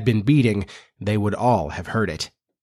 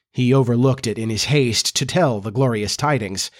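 ordinary delivery

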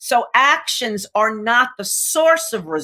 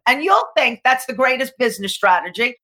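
ordinary delivery

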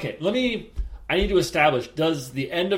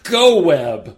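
loud spell word; 6 semitones higher, 11 dB louder than usual. Acted. Player invents silly spell word.

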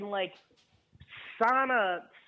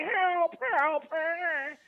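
high, calling for help in mock panic; imitating a helpless shout for help; mock cry for help; 9 semitones higher, much slower than usual. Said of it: "And went".

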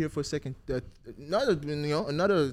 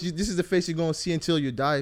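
ordinary delivery

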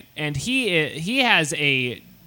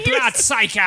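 mock Russian insult, pitched up; Russian accent; 5 semitones higher than usual. Russian boy insulting his rival, mock Russian accent.

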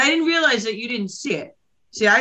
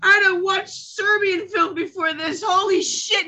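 incredulous; 8 semitones higher than usual. Real, not acted. Protesting the deep end.

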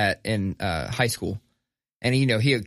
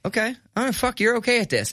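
grudging surprise; 9 semitones higher, faster than usual. Retold or imitated. Kyle grudgingly impressed.

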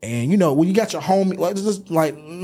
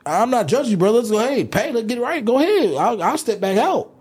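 flustered; 8 semitones higher than usual. Retold or imitated. Retold backing off to a friend.

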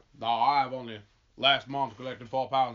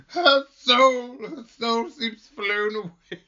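much higher, louder and very slow, tragic; old actress voice; 9 semitones higher, 8 dB louder, much slower than usual. Acted. Anne mourns her daughter's lost soul like a has-been actress. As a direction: wail it like an aging stage diva. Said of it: "reminds me of some, like, old actress".

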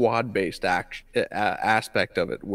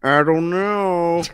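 coy teasing; 7 semitones higher, 8 dB louder, slower than usual. Pretend.

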